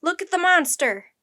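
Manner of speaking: high, loud cry of alarm; shrieking. A narrator's reading. Sailor warns the crew of the monster, shrieking. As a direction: shriek in horror. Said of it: "sailor shrieked".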